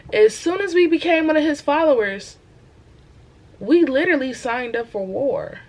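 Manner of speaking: loud, high, rousing preacher voice; fired-up preaching. Acted. Young believer preaching to viewers, loud and fired up. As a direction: preach it like a revival sermon.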